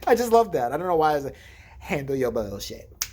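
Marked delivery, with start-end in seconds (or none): 1.8-3.1 low, slow, put-on catchphrase; slow mock-sultry put-down; imitated sassy stranger. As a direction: draw it out low, mimicking a drunk stranger.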